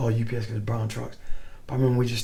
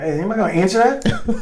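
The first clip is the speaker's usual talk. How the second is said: laughing call; 8 semitones higher, 6 dB louder than usual. Retold or imitated. Retold call about ringing phone.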